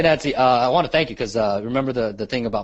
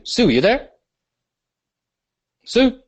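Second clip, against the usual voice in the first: raised, higher call for Sue; 12 semitones higher, 6 dB louder than usual. Real, not acted. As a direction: call her name louder and higher. Calling out to silent caller.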